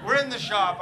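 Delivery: usual speaking voice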